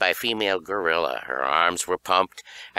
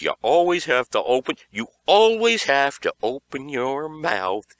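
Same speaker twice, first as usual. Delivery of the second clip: angry; 6 semitones higher, 4 dB louder than usual. A narrator's reading. Kai scolding before beating.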